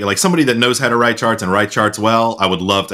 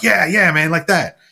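pleased producer; 10 semitones higher than usual. Retold or imitated.

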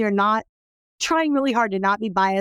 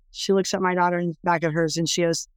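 ordinary talk

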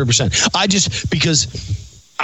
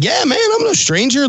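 much higher-pitched, game-for-anything reply; eager bravado; 13 semitones higher than usual. Retold or imitated. Answering a stranger's party offer in a bar, eager bravado. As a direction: play up the cocky party guy.

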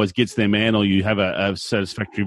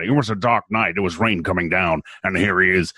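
put-on booming old melodrama narrator; 4 semitones lower than usual. Shown as a demonstration. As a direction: set a dark rainy scene, melodrama style. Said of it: "I feel it should be".

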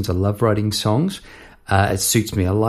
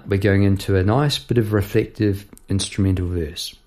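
ordinary talk, nothing marked

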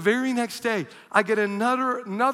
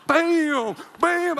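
loud high punchy bam; wrestling slam noises; 10 semitones higher, 5 dB louder than usual. Retold or imitated. God tag-teaming in the ring, loud high bams. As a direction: smack each bam like a hit.

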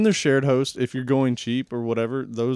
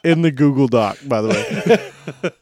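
louder, laughing through it, slowing at the end; 2 semitones higher, 7 dB louder, slower than usual. Real, not acted. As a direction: get it out between laughs. Laughs at the handwriting joke.

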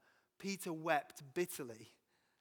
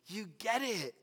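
laughing, rising to a louder cheer; playful Jesus praising; 2 semitones higher, 5 dB louder than usual. Pretend. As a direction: cheer him on like a delighted teacher. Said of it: "I wonder if Jesus just went".

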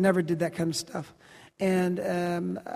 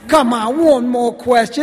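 higher, loud mock-British pleading; bad British accent; 4 semitones higher, 10 dB louder than usual. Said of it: "That's my really bad accent".

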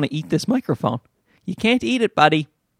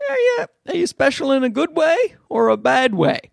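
put-on pitched way up, teasing the baby; high teasing baby talk; 9 semitones higher than usual. Teasing his baby son in a high, playful voice. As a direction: ask it high and playful, as if the baby could answer. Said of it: "I'm like".

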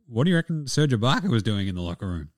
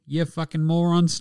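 usual speaking voice

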